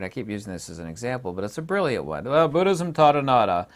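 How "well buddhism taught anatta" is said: mocking the critics. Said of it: "They all say the same thing".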